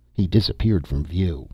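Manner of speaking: plain reading voice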